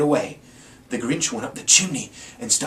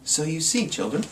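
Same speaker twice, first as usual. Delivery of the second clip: soft, lower bedtime telling; storybook moral; 4 semitones lower, 5 dB quieter than usual. A narrator's reading. Narrator closing the tale to the children, soft and gentle.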